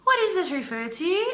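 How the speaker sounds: high, loud put-on agent voice; imitated phone agent. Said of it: "So Caleb goes like".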